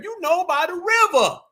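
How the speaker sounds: high mocking imitation of a faker; mock Tether reply. Host plays a Tether dodging the question where, high mocking imitation. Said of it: "they always say Carolina".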